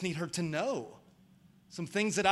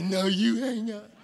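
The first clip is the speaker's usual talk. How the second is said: cutesy lovey voice; 3 semitones higher, 5 dB louder, slower than usual. Retold or imitated. Mimicking his wife on the phone while dating, cutesy. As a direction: coo it like a smitten girlfriend. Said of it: "she would say".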